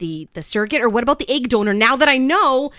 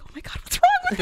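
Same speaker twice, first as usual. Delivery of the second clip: high, fast mock panic; panicked husband; much faster than usual. Husband reacting to crying new mom, fast high mock alarm. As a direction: blurt it like a startled husband. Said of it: "He's like".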